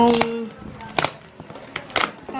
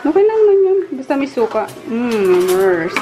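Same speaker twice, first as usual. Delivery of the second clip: normal talking voice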